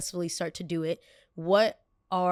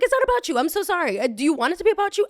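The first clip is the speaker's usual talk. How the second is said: loud, high-pitched argumentative retort; exasperated sarcasm; 11 semitones higher, 7 dB louder, faster than usual. Retold or imitated. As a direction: argue back with a raised, soaring voice. Retold argument with the lady.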